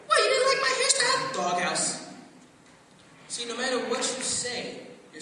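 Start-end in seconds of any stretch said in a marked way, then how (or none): 0.1-1.3 high, loud mock indignation; offended wife. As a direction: fire back as the insulted wife. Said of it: "If you say".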